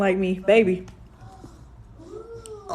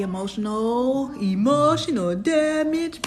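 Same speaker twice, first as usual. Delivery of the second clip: meme voice; 5 semitones higher, slower than usual. Pretend. Meme phrase, slowed.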